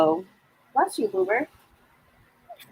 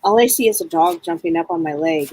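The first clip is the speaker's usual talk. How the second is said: usual speaking voice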